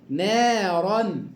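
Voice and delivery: نارا said very slowly, ra thick and full; slow heavy ra. Shown as a demonstration. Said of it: "را مفخمة مفتوحة".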